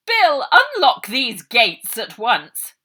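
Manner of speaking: loud, slow barked order; commanding shout. A narrator's reading. Matron ordering Bill to unlock the gates, loud command. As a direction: order him sharply to unlock.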